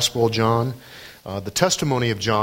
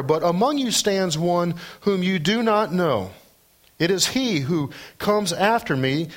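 higher-pitched, declamatory Bible reading; scripture reading; 6 semitones higher than usual. Acted. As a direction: proclaim the passage to the congregation. Reads scripture dialogue aloud.

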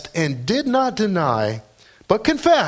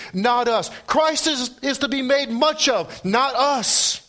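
preaching at full force; 10 semitones higher than usual. Acted. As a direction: lift the congregation with each line.

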